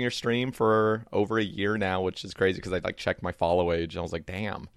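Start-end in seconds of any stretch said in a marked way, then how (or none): none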